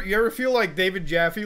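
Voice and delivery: ordinary delivery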